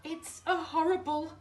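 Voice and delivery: high, loud terrified squeal; panicked squeal. Acted. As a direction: shriek in fright. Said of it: "squealed Tiger".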